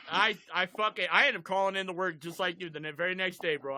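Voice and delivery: normal talking voice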